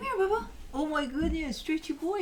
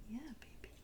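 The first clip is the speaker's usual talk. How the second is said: whisper; 3 semitones higher, 15 dB quieter than usual. Real, not acted. Owner soothing her dog.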